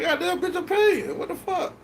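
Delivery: high, incredulous mock complaint; exasperated mock outburst. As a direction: throw it up high like you're fed up.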